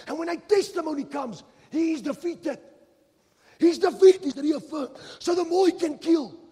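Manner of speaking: forceful sermon climax, pitch climbing; full-force preaching. Acted. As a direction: build to a loud, high preacher's shout.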